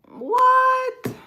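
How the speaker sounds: a very high, loud long what; can't believe the score; shocked shout. Real, not acted. Reacts to the Knicks comment.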